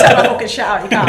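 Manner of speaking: fast, loud, laughing; laughing mock retort. Teasing the host, laughing out a mock retort. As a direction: fire it back through laughter.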